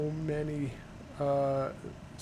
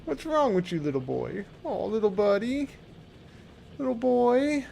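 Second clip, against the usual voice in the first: high, louder cutesy pet voice; high doting kitty voice; baby talk to cat; 9 semitones higher, 6 dB louder than usual. Retold or imitated. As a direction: fuss over the cat up high. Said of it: "I'm like".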